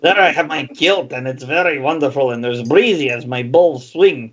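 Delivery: lilting comic Scottish brogue; hammed-up Scottish boast; wobbly put-on Scottish accent. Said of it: "You just went to Scotland".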